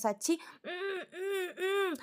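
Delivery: soft, high whimpering hums through closed lips; stifled pained hums. Retold or imitated. Sachi with a crushed foot, stifled pained moaning. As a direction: hold back the pain, let only little moans out. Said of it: "കുറേ മൂളിയൊക്കെ നോക്കും".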